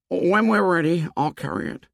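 put-on high, earnest boyish voice; a timid boy offering eagerly; young Piggy, pitched well up. A narrator's reading.

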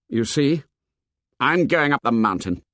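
mildly vicious declaration; understated venom, firm and defiant. A narrator's reading. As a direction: throw it out as though it were a curse. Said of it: "The words came from Jack viciously".

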